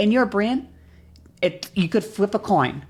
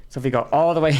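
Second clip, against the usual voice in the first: ordinary talk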